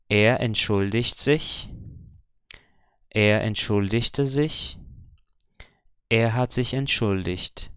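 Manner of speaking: entschuldigt slowly, with -igt said as -icht; slow model sentences, G in entschuldigt as CH; ch sound for G. Shown as a demonstration. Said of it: "continua com a pronúncia do C H".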